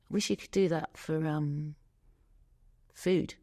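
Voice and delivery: ordinary talk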